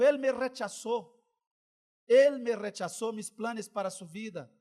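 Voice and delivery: firm, loud divine lament; God's voice naming the rejection. Retold or imitated. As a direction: declare it as God, heavy and loud. God's voice, retold.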